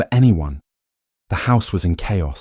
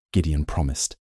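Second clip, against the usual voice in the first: plain reading voice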